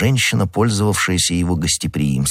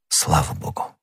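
hushed, low sigh of relief; quiet relief; 5 semitones lower, 7 dB quieter than usual. A narrator's reading. Aramis relieved that the secret is safe, hushed relief. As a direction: say it softly under the breath. Relieved reply after assurance.

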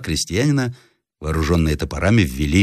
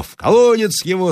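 high, raised-voice peasant outcry; crowd yell; 13 semitones higher, 4 dB louder than usual. A narrator's reading. Peasants demanding the prisoner be thrown down the well, yelling. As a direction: cry it out high, as a clamouring mob. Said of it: "завопили почти все крестьяне".

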